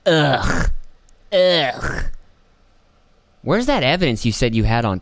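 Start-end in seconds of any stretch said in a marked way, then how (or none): 0.1-2.0 throaty "ugh" of revulsion; disgusted groan. Real, not acted. Commentator loathing the congressman, disgusted groan. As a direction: groan as if sickened by him.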